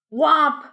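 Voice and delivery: loud, high crash sound; loud whomp. Acted. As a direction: shout the impact noise. Collision sound effect.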